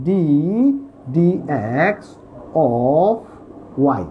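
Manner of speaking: "d dx of y" slowly, word by word with pauses; slow careful pronunciation. Shown as a demonstration. Teacher shows students the correct reading, slow and careful. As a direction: draw out "d dx of y", pausing between the parts. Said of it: "এটাকে উচ্চারণ হবে".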